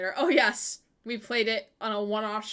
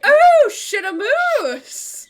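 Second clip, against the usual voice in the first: much louder, much higher, laughing; excited shout; 13 semitones higher, 16 dB louder, much slower than usual. Real, not acted. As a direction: shout it with delighted surprise. A new viewer arrives.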